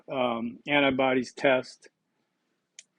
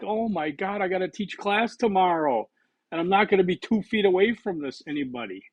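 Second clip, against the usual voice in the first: mock alarm; 6 semitones higher than usual. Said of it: "I'm like".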